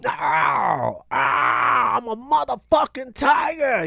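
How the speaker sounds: high, slow shouted roar of a claim; imitating brother as a tiger. Brother yelling that he is a tiger, high slow shout. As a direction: yell it like a wild teen who thinks he's a tiger. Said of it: "I just remember him going".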